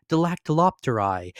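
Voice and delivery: usual speaking voice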